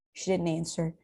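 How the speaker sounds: usual speaking voice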